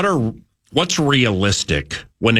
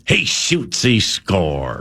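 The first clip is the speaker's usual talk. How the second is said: hockey play-by-play; 3 semitones lower, much slower than usual. Acted. Teasing a Hermantown kid's hockey roots, play-by-play call. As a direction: call the goal like a hockey announcer. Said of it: "Usually the Hermantown vibe is".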